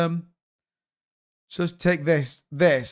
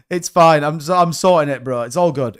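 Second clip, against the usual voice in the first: normal talking voice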